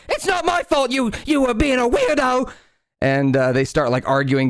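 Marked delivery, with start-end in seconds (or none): 0.1-2.5 much higher, squeaky goblin yelling back. Acted. Goblin cook snapping back at the rat, high squeaky voice. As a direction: pitch way up as a bickering goblin. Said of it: "as the goblin goes".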